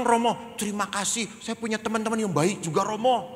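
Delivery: put-on high-pitched, earnest kid telling a story; spirited little child.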